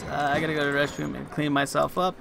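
usual speaking voice

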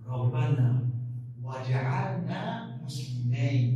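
récitation lente et appuyée du verset; récitation coranique lente. Shown as a demonstration. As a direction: psalmodier lentement, mot par mot. Reciting the verse being explained. (récitation du verset, très lente)

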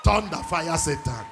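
loud declaring shout; fiery shout. Real, not acted. Preacher calling down fire, loud fierce shout. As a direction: hurl the words like a curse.

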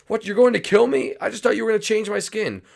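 very high, fast frightened voice; panicked sidekick. Acted. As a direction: blurt it out in rising panic. Sidekick learns he will die.

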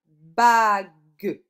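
loud, very slow two-beat word; sounded out. Shown as a demonstration. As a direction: stretch it to show the hidden sound. Teacher sounding out the answer word. (mot découpé, lent et appuyé)

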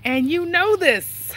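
hint of a Chris Tucker voice; slow drawn-out catchphrase, lightly done. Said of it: "I can't say it like Chris Tucker".

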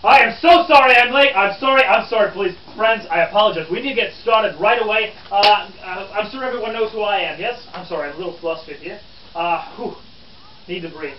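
loud, apologetic late arrival; flustered reporter. Acted. Late reporter apologizing to his friends, flustered. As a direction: hurry in apologizing, flustered and out of sorts.

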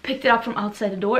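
ordinary talk

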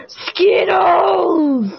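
drawn-out, hammed-up shout; hyper video quote. Player teasing friend with video catchphrase, hammed-up shout. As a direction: stretch the word out like a silly catchphrase.

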